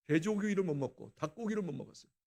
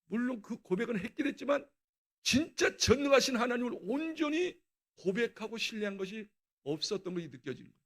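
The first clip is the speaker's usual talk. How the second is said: usual speaking voice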